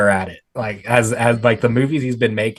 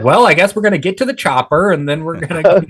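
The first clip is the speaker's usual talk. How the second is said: higher, mild-mannered everyday American voice; Arnold Schwarzenegger as regular guy; 7 semitones higher, 4 dB louder than usual. Pretend. Host imagining an action star without accent, ordinary-guy mock voice. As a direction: play the action lines as casual, unaccented chat. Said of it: "he was just talking like, like a regular person".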